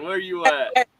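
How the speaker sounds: ordinary talk, nothing marked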